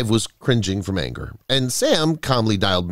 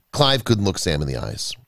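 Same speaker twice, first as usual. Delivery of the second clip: plain reading voice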